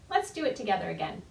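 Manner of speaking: ordinary delivery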